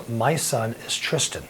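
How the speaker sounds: quiet and slow, a touch of grief; mother naming her hurt son, softly and slowly. Retold or imitated. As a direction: say it gently and let it sink. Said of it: "And she goes".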